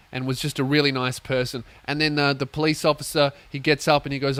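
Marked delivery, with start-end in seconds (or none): none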